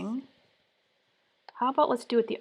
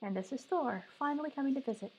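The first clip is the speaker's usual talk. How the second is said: soft doting dog talk; 4 semitones higher, 11 dB quieter, faster than usual. Real, not acted. Introduces her dog to viewers.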